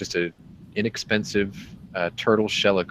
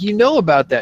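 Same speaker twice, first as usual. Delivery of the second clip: high, raised mock amazement; pitched up and louder, acting taken aback; surprised question; 10 semitones higher, 5 dB louder than usual. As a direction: replay your astonishment at the seller. Acts out surprise at dealer.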